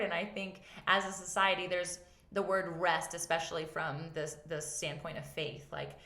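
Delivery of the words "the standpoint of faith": ordinary talk, nothing marked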